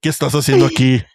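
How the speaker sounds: joking as the Hat Man who is a creepy uncle; creepy tío. Pretend. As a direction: play the nosy, menacing uncle demanding answers. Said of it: "Creepy tío".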